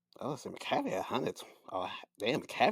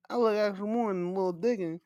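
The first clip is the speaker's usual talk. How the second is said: ordinary talk, nothing marked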